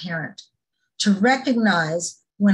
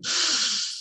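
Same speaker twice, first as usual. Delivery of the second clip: quiet, exasperated breath out; a tired sigh at the kids not listening; frustrated sigh; 7 semitones higher, 6 dB quieter than usual. Retold or imitated. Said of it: "talking to myself".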